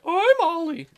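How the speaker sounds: high goofy little voice; high cartoon voice for Ollie; silly Ollie voice. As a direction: squeak it out as Ollie. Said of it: "Ollie's in the back and is like".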